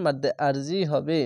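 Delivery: usual speaking voice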